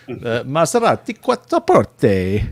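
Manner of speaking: Quattro Porte rolled out with Italian vowels, "kwah-tro por-teh"; mock-Italian flourish on Quattro Porte; Italian pronunciation. Shown as a demonstration. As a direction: savour Maserati Quattro Porte like a native Italian. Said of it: "all that really means is".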